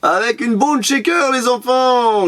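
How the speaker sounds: high, brisker showman's call; playful announcement. Pretend. Vlogger telling the kids watching about the toy, playful announcement. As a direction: call it out high at an ordinary pace. Repeats reveal at brisker pace to viewers.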